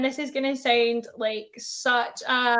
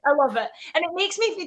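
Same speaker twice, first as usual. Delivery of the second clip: ordinary delivery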